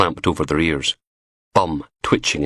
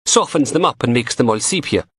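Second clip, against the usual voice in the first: higher teasing female voice; young woman voice; 6 semitones higher than usual. A narrator's reading. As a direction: play the cheeky lab tech, higher. Narrator voices Samantha.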